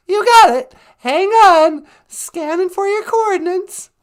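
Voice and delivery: squeaky teleport operator voice; high put-on caller voice. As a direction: answer like an eager ship officer. Other voice on the call.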